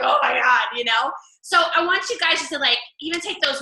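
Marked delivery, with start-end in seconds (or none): 0.0-0.6 louder mock gasp of shock; shocked reaction. Followers reacting to the grocery story, loud mock shock. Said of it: "people were like".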